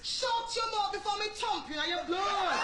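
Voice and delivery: hammed-up Jamaican teacher's outburst; mock angry teacher. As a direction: cut the pupil off with a threat. Teacher threatens an interrupting pupil.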